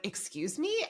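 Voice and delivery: high, hushed offended "excuse me"; a scandalized double take, lightly acted; mock offended. Imitating Eric taking offense, high and hushed mock shock. Retelling Eric's offended reaction.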